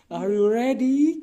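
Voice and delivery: slow, loud, high hype announcer; announcer call. Pretend. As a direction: drag it out like a ring announcer. Testing echo, announcer call.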